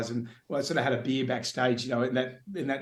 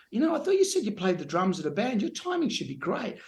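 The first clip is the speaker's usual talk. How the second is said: puzzled dance teacher; 7 semitones higher than usual. Retold or imitated. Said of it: "Alana, my dance teacher, said".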